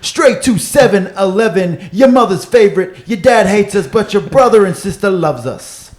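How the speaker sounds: high, loud, over-the-top American announcer; hyped American accent. Acted. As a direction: sell the podcast like a loud American host. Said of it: "I don't know why I do American accent".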